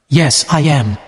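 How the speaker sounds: usual speaking voice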